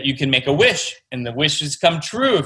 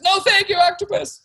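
very high, sing-song refusal; boy's protest. Acted. Boy refuses octopus prank again, very high sing-song. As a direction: squeak it out like an exasperated kid. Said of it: "And the boy says".

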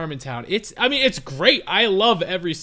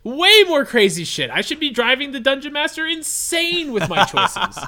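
higher, louder, gleeful self-quote; excited realization; 7 semitones higher, 4 dB louder than usual. Retold or imitated. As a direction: play it as a sudden giddy epiphany. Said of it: "I was like".

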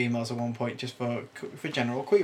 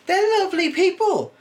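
much higher and louder, effusive; gushing high praise; 7 dB louder than usual. Real, not acted. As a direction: squeal it out fondly. Praising call centre staff.

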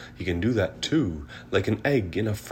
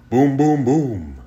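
much louder booming thunder; 7 semitones higher, 11 dB louder, slower than usual. A narrator's reading. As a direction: roll out big thunder. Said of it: "Mister Brown makes thunder".

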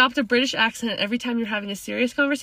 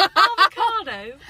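high, loud, drawn-out British lilt; mock British accent; 7 semitones higher, 6 dB louder, slower than usual. Shown as a demonstration. Friend demonstrates British accent mid-laughter, hammed-up British. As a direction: put on a British accent, stretching the word. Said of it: "I was using a British accent".

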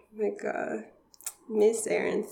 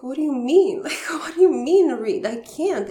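louder, higher, half-laughing disbelief; mock bewildered protest; 8 semitones higher, 8 dB louder than usual. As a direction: replay the panicked protest with a laugh. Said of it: "I was like".

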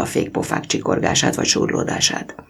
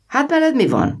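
put-on high, louder call to the boy; 13 semitones higher, 3 dB louder than usual. A narrator's reading. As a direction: shout it across to the boy. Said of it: "kiáltott oda a mozdonyvezető".

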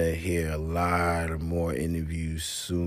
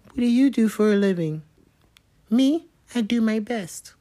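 high falsetto quote; high mock girlish voice; 4 dB louder than usual. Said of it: "She said".

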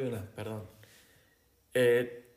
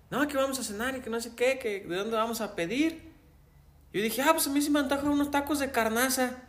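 higher, bright storytelling lift; lively retelling; 8 semitones higher than usual. Retold or imitated. Telling a friend about Christmas dinner, lively and higher. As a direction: build up the family dinner story. Retold family dinner scene.

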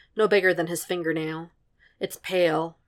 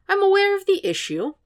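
higher, patient but strained; patiently; 4 dB louder than usual. A narrator's reading. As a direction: keep patience with effort. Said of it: "Sokka says patiently".

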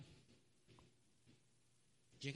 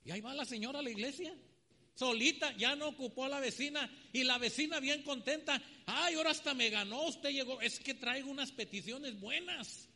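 loud, higher, hurried telling; excited storytelling; 6 semitones higher, 6 dB louder than usual. Retold or imitated. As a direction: build the eager rush. Eager woman rushing to church. (el predicador cuenta emocionado, fuerte y agudo)

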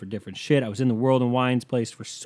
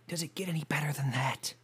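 whisper; 2 semitones higher, 8 dB quieter than usual. Shown as a demonstration. Repeated line, whispered.

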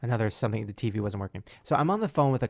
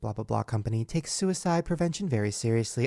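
mock customer service; 6 semitones higher, 9 dB louder than usual. Host imitating the tired phone agent, prim and scripted. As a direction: recite it like a stiff company script.

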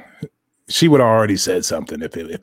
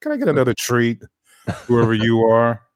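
higher, louder begging dog voice; bulldog voice; 4 semitones higher, 4 dB louder than usual. Pretend. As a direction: beg for a treat as a bulldog. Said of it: "That's a bulldog voice for you".

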